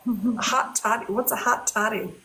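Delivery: usual speaking voice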